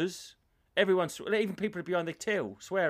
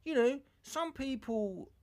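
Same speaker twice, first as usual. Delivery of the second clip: higher, slow put-on doctor; higher, slow mock doctor to the patient; doctor explaining; 10 semitones higher, slower than usual. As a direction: draw it out like a patronising doctor. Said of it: "he goes".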